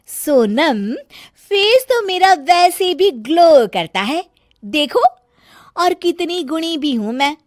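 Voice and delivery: high loud scolding, showing off; boastful bahu. Acted. Bahu bragging and scolding Sonam about her room, loud high voice. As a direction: brag and nag in one breath.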